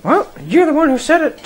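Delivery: ordinary talk, nothing marked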